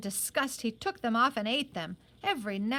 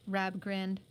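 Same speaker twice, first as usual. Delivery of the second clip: ordinary delivery, nothing marked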